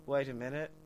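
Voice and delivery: put-on mild British accent while shopping. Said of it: "we were all doing our British accents".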